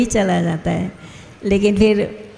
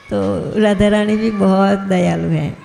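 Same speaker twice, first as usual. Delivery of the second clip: ordinary delivery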